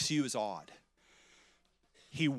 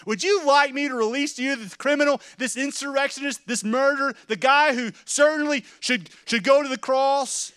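Pilate's loud question; 6 semitones higher, 7 dB louder than usual. Acted. Pilate asking the crowd.

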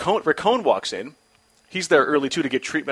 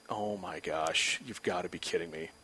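hushed dread; 8 semitones lower, 15 dB quieter than usual. Retold or imitated. Pitcher seeing the Hall of Famer on deck, hushed dread. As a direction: breathe it out in disbelief.

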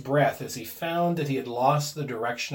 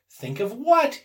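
high, louder puzzled question; ask, baffled, with a rise at the end; 9 semitones higher, 5 dB louder than usual. A narrator's reading. Husband baffled by his agitated wife, questioning. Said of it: "he questioned".

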